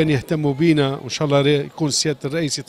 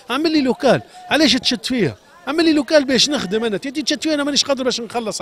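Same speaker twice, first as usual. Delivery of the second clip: higher pitched, exasperated demand; frustrated plea; 6 semitones higher than usual. Real, not acted. Shoemaker to the reporter, demanding a workshop, higher and exasperated.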